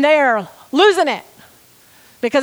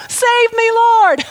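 higher, louder mock cry of a sinking man; cry for help; 9 semitones higher, 5 dB louder, much slower than usual. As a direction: beg for rescue, hammed up. Peter sinking cries for help.

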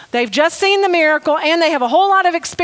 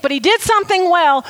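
ordinary delivery

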